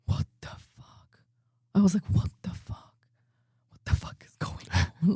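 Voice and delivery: whispered disbelief, breathy and hushed; stunned whisper. Retold or imitated. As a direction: whisper it in shock on stage. Somebody recorded my reaction.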